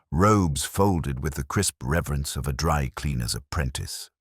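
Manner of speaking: ordinary delivery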